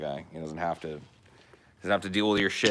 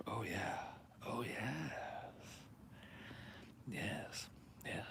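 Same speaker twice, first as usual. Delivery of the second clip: softly whispered delight; 4 semitones higher, 19 dB quieter, slower than usual. Real, not acted. Gamer whispering to himself as the move works. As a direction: breathe it out, pleased. Pleased after risky move.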